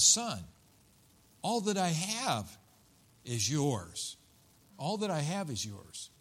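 soft, unhurried reassurance with pauses; tender father. Acted. Father answers his resentful son, tender. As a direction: soothe him, letting each phrase settle.

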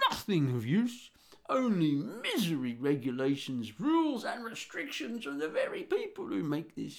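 put-on loud, ranting old boomer. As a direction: rant like an indignant old boomer. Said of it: "I've gotta do my boomer voice".

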